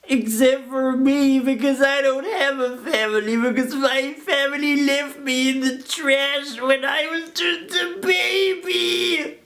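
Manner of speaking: high, slow melodramatic lament; milk the tragedy shamelessly; hammed-up self-pity.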